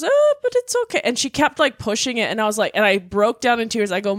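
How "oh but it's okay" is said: much higher, sing-song mom imitation; high mock mom voice. Imitating her mother brushing off grief, high sing-song voice. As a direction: pitch it way up like a dismissive mom. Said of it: "She goes".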